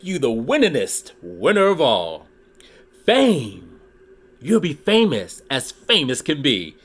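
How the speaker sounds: lively read-aloud with rhyming bounce; storybook reading. Acted. Reading a Dr. Seuss book to his YouTube viewers, lively storytelling. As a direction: read it like a children's picture book.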